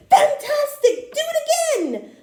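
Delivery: very high, loud excited shout. Acted. Elizabeth egging the tired dragon on, very high loud shout. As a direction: cheer him on at full voice. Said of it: "Elizabeth shouted".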